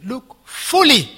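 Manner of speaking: fully stretched slow and loud; stress on fully. Shown as a demonstration. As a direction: lean hard on "fully". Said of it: "The key word is fully".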